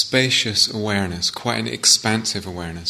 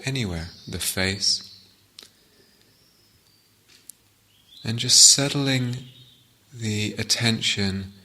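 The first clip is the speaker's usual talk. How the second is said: guided meditation. Acted. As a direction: guide listeners gently inward, phrase by phrase, leaving silences.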